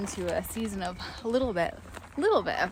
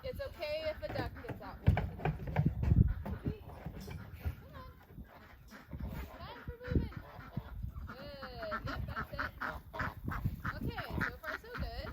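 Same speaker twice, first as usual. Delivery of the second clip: hushed, gentle calls to animals; soft coaxing voice; 4 semitones higher, 9 dB quieter, faster than usual. Real, not acted. Quietly herding geese.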